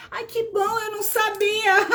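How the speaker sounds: high-pitched, real delight; delighted surprise. Real, not acted. Teacher reacting to a viewer's thanks for the explanation, delighted high voice.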